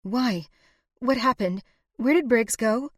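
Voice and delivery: rising anxious questions. A narrator's reading. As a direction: press for answers, growing alarm. Anxious wife questions brother-in-law.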